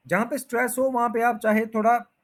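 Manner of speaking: ordinary delivery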